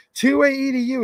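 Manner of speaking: high, loud imitation of an angry commenter; mock all-caps yelling. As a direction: call it out like typed capitals. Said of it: "He puts it in all caps".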